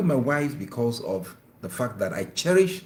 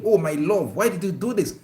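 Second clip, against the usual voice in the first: tender complaint with pet name, higher; gentle loving rebuke; 7 semitones higher, 4 dB louder than usual. Shown as a demonstration. Husband gently scolding his wife, loving tone. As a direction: scold with love, not anger. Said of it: "You could say the word".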